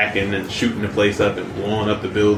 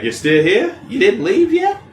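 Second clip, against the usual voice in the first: teasing Harley voice; 10 semitones higher than usual. Retold or imitated. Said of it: "she's like".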